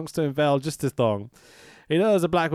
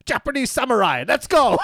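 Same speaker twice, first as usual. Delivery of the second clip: imitating a hyped-up wrestler's rallying shout; louder, higher pumped-up shout; 7 semitones higher, 4 dB louder than usual. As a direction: yell it to the camera like a battle cry. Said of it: "Dino turns into the camera and goes".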